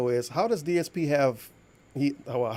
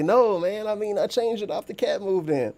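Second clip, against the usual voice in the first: mocking imitation; 7 semitones higher, slower than usual. Mocking the streamer's answer.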